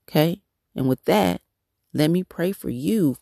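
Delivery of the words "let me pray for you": usual speaking voice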